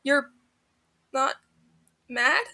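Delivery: croaking. A narrator's reading. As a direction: croak it out, close to tears. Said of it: "Grian croaks".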